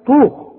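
طو thick and heavy, loud and high; heavy emphatic ط. Shown as a demonstration. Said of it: "زي ما تقول".

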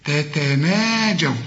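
تتناجوا with both taa said slowly and distinctly; two clear taa sounds. Shown as a demonstration. Teacher drawing out تتناجوا for the student. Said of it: "عندك اتنين تاء".

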